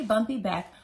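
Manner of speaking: plain reading voice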